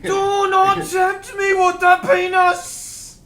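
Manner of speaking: slow, loud, high wizard boom; Gandalf. Pretend. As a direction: bellow like a hammed-up wizard, drawing out each word. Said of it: "can you do a Gandalf impression?".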